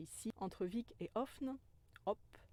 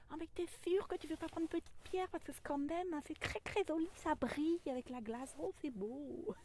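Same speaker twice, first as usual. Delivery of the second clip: higher, fast wheedling little voice; coaxing inner ego; 8 semitones higher than usual. Pretend. Ego tempting her to take a shiny stone, high and wheedling. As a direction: tempt yourself like a sly child. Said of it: "mon ego qui fait".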